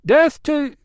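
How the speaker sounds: high, loud cry that dies off; lone voice crying out. A narrator's reading. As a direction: shout alone, then trail away. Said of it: "A lone voice cried".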